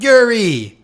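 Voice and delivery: imitated high, loud cry of a name; mock panicked yell; retold yell. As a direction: call out the name as if someone is falling. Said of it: "he yells out".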